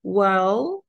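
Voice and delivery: "well" held long with stress, rising at the end; drawn-out starter. Shown as a demonstration. Teacher repeating the starter for learners, lengthened "well". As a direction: stretch out "well" as a hesitant opener. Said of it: "هتلاقوا عليها stress كده وبيطولوها".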